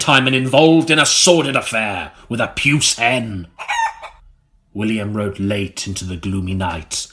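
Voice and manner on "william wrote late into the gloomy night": sinking lower and softer to a murmur; gloomy narration. A narrator's reading. Narrating William's gloomy night, lower and softer. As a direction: let the voice sink into the gloom. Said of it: "You wouldn't guess it was called the Gloomy Room".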